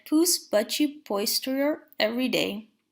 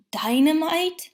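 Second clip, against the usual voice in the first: higher, louder shocked question; alarmed cry; 5 semitones higher, 5 dB louder than usual. A narrator's reading. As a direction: gasp it out in alarm. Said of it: "Pooh cried".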